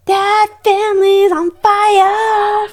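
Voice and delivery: high, loud, slow dramatic declaration; dramatic exclamation. Real, not acted. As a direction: stretch it out like breaking news. Dramatic tarot reading exclamation.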